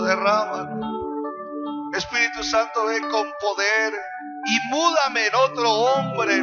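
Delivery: ordinary talk, nothing marked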